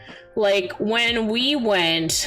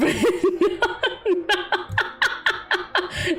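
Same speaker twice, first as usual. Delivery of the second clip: high, bubbling cackle; laughing fit; 9 semitones higher than usual. Real, not acted. Streamer pictures band kids performing on coaster, bursts out laughing. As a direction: burst out laughing mid-word.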